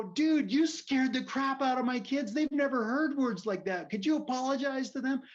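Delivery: high, loud re-enacted shout; still yelling. Dad asking the man to apologize to kids, yelling. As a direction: yell a friendly request as if still in a row. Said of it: "we're still kinda yelling".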